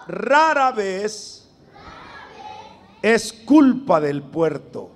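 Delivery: slow, firm phrase for the congregation to echo; slow dictation. Shown as a demonstration. Preacher dictating a saying for the youth to repeat, slow and firm. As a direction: lay it out phrase by phrase, strong. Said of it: "repitan lo que voy a decir, pero fuerte".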